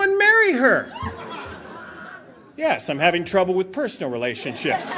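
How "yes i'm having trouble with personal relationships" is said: low, subdued mock admission; deadpan understatement. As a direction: drop down low for a dry punchline. Understated punchline after wild confession.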